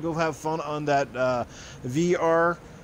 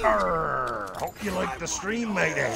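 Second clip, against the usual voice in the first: slow growly pirate drawl; slower than usual. Acted. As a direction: ham it up like an old pirate. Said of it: "say all that in a pirate voice".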